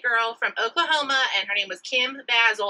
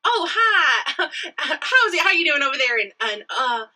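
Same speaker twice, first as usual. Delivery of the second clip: higher, louder flirty phone opener; sexy sales pitch voice; 6 semitones higher, 5 dB louder than usual. Shown as a demonstration. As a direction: sell it like a flirty telemarketer. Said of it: "I'm gonna do it for you now".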